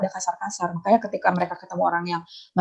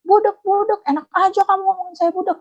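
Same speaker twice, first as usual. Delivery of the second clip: high mock outraged yell; high imitation of an angry debtor's outburst; imitating his rage; 10 semitones higher than usual. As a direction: play the offended man raging back. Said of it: "Dia ngamuk".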